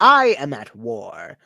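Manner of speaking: menacing warlord, slow and cold; a petty warlord sneering at the visitors. Acted. GM voices warlord Goshnock.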